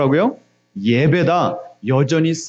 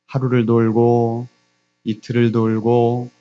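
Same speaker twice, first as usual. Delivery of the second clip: slow, low, evenly droned count; drawn-out repetition; 6 semitones lower, slower than usual. Acted. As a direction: drag out each day of circling. 성을 날마다 도는 모습 재현. (느리고 낮게 늘여 반복하는 말투)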